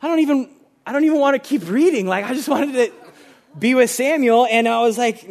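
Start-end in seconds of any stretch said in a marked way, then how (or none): 0.0-2.1 higher, hurried protest; retold dismay. Retelling a reaction to Samuel's death, dismayed. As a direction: relive the dismay in a quick, raised voice.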